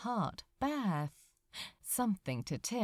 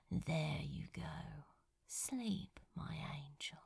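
whispered; 4 semitones lower, 10 dB quieter than usual. Acted. Crow soothing Azra to sleep, tender whisper. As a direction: whisper him back to sleep, gently. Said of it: "he whispered".

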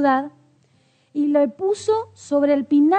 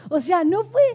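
ordinary talk

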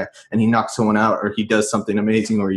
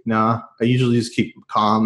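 ordinary talk, nothing marked

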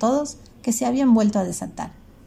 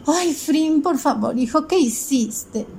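high, worried mother's reproach; a relieved mom scolding her runaway son; 7 semitones higher, slower than usual. Acted.

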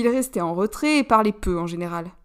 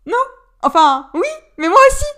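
much higher and louder, blurting out; eager cry; 11 semitones higher, 10 dB louder than usual. A narrator's reading. Alistair claiming he dated him too, crying out loud. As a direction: jump in with an excited shout. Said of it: "s'écria Alistair".

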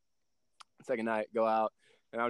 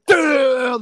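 louder and higher burst of awe; amazed shout; 9 semitones higher, 9 dB louder than usual. Retold or imitated. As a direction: blurt it out, floored. Amazed at coach's compliment.

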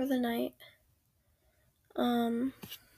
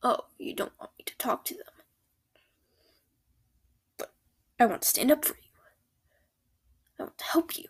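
ASMR whisper. Acted. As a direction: whisper gently, close to the ear. Whispered comforting boyfriend roleplay.

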